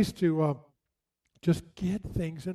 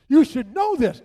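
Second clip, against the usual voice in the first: loud, high scolding insistence; the mother, loudly scolding her grown children; indignant mother; 7 semitones higher, 9 dB louder than usual. Retold or imitated. Mother insists kids know pallbearers.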